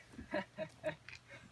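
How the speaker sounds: high, soft mock evil cackle; witch cackle. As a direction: snicker wickedly as the witch. Acted witch's laugh.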